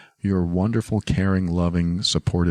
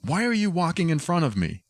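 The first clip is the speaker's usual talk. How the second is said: much higher, irritated question; an irritated demand, pitched up; annoyed, raised voice; 11 semitones higher than usual. Shown as a demonstration. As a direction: snap it out, annoyed and high. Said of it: "I'm louder, the frequency of my voice raised".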